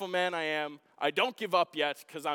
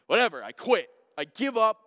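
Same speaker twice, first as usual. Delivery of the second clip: angry quitting; 3 semitones higher, 8 dB louder than usual. Retold or imitated. Frustrated over stuck bolt.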